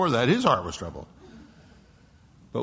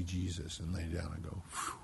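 soft relieved sigh; 5 semitones lower, 10 dB quieter than usual. Retold or imitated. Dying people seeing Jesus.

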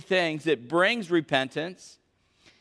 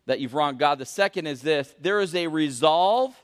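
ordinary talk

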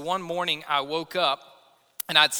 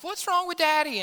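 high small-child voice, imitated; little son; 11 semitones higher than usual. Toddler asks mom about dad's neck, high child voice. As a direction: play the worried toddler, pitched high. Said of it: "he's like".

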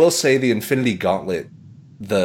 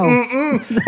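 dismissive refusal; 13 semitones higher than usual. Retold or imitated. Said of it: "she's like".

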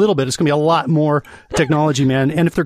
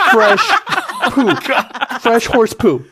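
high laughing squeal on fresh; amused laughter over the word fresh; 10 semitones higher, slower than usual. Real, not acted. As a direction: crack up as you say it. Cracking up at fresh poo.

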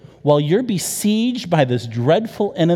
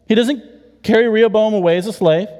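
ordinary talk

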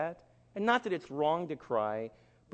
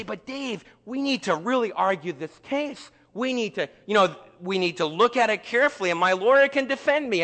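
mock pleading, raised pitch; 5 semitones higher, 6 dB louder than usual. Accused client urging Dave to argue the case, mock pleading. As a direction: argue the case, insistent and higher. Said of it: "And they're pleading".

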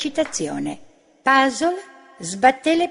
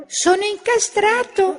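whining complaint; 8 semitones higher than usual. A narrator's reading. Little Bu stuck in a shoe, whining to Mira. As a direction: moan it out, sorry for yourself. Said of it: "si lamentò lui".

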